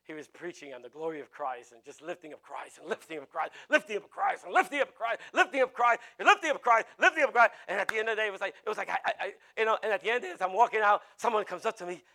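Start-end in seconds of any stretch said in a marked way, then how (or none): 3.7-7.5 loud, high, insistent repetition; rousing preacher's refrain. Retold or imitated. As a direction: hammer it out like a fired-up preacher. Repeating another preacher's rousing refrain.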